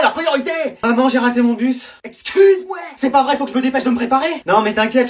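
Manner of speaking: ordinary delivery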